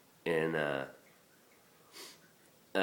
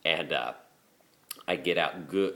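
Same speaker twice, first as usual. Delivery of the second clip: usual speaking voice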